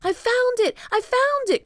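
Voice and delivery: put-on thrilled high boy's cry; excited cry. A narrator's reading. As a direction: burst out with the discovery. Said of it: "cried Jack".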